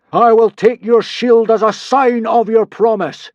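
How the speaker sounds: slow, loud, high god voice; crying out. Acted. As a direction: cry it out commandingly. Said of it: "cried Hiccup".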